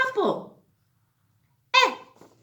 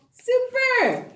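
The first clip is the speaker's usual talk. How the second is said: ordinary talk, nothing marked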